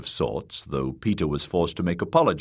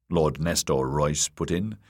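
ordinary delivery, nothing marked

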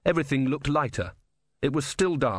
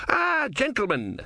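very high, drawn-out mock welcome; grand greeting; 13 semitones higher, slower than usual. Philosopher greeting soldiers who burst in, grand drawn-out welcome. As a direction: greet them airily, pitched way up.

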